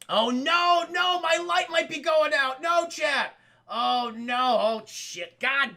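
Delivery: loud, high panicked "oh no" at the light; real alarm as the light dies; alarmed cry. Real, not acted. Streamer yelling to chat as his light fails, alarmed.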